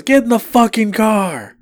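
high, slow, angry command; girlfriend's angry order. Retold or imitated. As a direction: snap at him, drawn out. Girlfriend orders drunk boyfriend.

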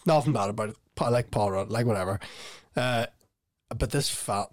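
ordinary talk

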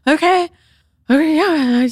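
high, loud, flustered okays; unraveling mom. Retold or imitated. Mother hearing her daughter is pregnant, high flustered unraveling. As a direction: imitate a mother starting to unravel. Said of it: "she was starting to become, like, unraveled".

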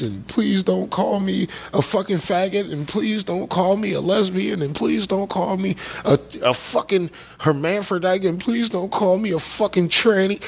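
repetitive, derisive imitation of begging; mocking plea. Mocking people pleading in safe spaces.

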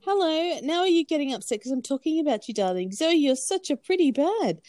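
high sing-song voice to the bird. Real, not acted. Talking to her pet bird.

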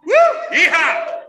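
loud high whooping yeehaw. Real, not acted. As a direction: whoop it like a cowboy. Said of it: "Can I get a yeehaw".

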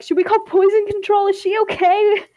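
high-pitched mock panic; retold freak-out. Kids freaking out over a poisoned friend at lunch, panicked. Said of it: "we were all just freaking out".

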